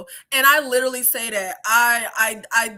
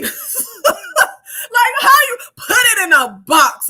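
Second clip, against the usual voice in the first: loud, half-laughing, half-crying outburst; cry-laugh in disbelief at mum's reply; laughing through tears; 10 semitones higher, 7 dB louder, much slower than usual. Real, not acted. Recounting mother's reply, laughing and crying.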